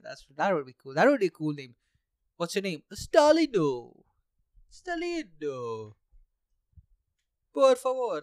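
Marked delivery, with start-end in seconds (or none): 2.9-3.9 announcing the name. Shown as a demonstration. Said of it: "Stalino is actually a pretty Italian name". 4.7-5.9 slow, soft "Stalino" in a put-on Italian accent; mock Italian accent. Shown as a demonstration. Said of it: "I'm not great with accents". 7.5-8.2 mock Spanish. Shown as a demonstration. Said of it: "That's Spanish".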